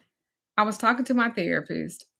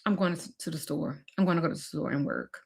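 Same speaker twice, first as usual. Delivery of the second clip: quiet retreat; 3 semitones lower, 8 dB quieter, faster than usual. Retold or imitated.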